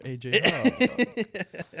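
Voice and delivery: very slow, stretched, high name call; announcing a player's name to hype him; mock announcer. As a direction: draw the name out like a ring announcer. Hyping a name, announcer-style.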